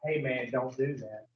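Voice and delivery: hushed, low acted warning; little inner voice. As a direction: drop down low and soft as the voice within. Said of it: "every time you heard that voice say".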